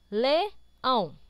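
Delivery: Leão split firmly into le-ão; syllable by syllable. Shown as a demonstration. Teacher checks the division for pupils, syllable by syllable. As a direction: pause between the two syllables of Leão. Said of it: "todas as palavras estão separadas".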